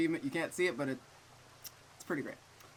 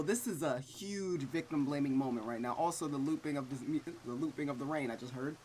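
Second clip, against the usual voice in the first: normal talking voice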